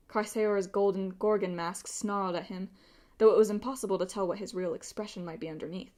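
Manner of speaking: usual speaking voice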